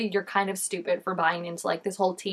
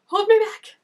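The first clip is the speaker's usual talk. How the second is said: high, loud hold me back; hammed-up rage at the screen; loud mock threat; mock furious yell; 12 semitones higher, 9 dB louder than usual. Mock fight at character.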